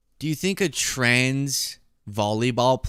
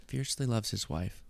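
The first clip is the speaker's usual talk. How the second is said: hushed repeat, much softer; savouring a quote; 10 dB quieter than usual. Retold or imitated. Host mulling over Marjorie's word fiercely, hushed and savouring. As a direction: say it softly to yourself.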